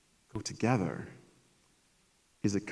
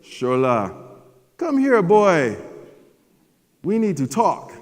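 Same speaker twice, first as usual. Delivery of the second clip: higher, louder, slow commanding father; stern African dad; 8 semitones higher, 7 dB louder, slower than usual. Retold or imitated. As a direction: summon the boy like a stern father. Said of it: "like, I got his voice down".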